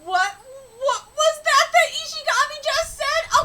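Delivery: high, loud incredulous question; shocked. Real, not acted. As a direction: demand it in disbelief. Shocked by the line read.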